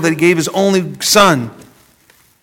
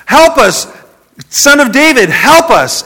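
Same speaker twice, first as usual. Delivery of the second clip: raised, higher plea for help; pleading blind man crying out; calling out; 8 semitones higher, 5 dB louder than usual. Retold or imitated. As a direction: call out to him from the roadside. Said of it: "two blind men calling out".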